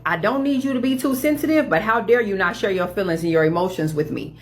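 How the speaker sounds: usual speaking voice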